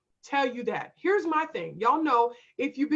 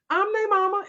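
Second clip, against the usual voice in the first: firm, higher-pitched declaration; assertive demo; 8 semitones higher, 4 dB louder than usual. Shown as a demonstration. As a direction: model it as a confident comeback. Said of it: "say it just like that".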